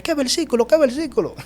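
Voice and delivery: high, loud, urgent repeated question; startled eager question. Retold or imitated. Asking the Father which verse after a jolt, excited and high. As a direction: imitate your own frantic asking. Startled, asks God back.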